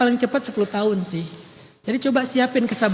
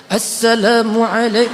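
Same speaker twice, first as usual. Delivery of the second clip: a slow, lightly voiced big-stage preacher greeting; slow, drawn-out sermon opening, half imitated; imitating Zainuddin MZ; 3 dB louder, slower than usual. Imitating a famous preacher opening a mass sermon, slow and drawn out. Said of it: "ceramah kayak Zainuddin M Z".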